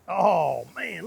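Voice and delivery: usual speaking voice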